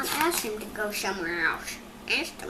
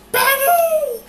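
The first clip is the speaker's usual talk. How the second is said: high, loud squeal of alarm; startled shriek; 12 semitones higher, 10 dB louder than usual. Acted. Elephant shrieking as a bird lands on his head. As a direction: shriek it out in shock.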